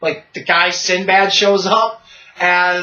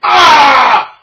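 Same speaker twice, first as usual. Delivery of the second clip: loud, high monster roars; roar, then bellow the last words; Hulk; 9 semitones higher, 7 dB louder, much slower than usual. Retold or imitated. Hulk smashing the podium, roaring. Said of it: "tucks it back, and goes".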